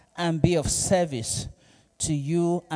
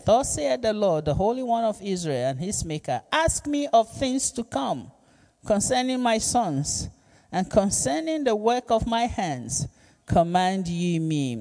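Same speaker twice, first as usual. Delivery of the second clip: scripture reading; 4 semitones higher than usual. Acted. Reads scripture verse aloud.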